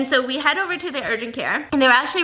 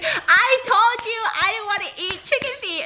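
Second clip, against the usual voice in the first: mock angry yell; 8 semitones higher than usual. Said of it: "I start yelling at him".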